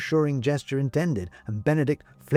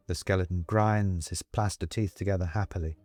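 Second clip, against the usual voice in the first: ordinary delivery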